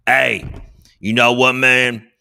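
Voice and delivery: ordinary talk, nothing marked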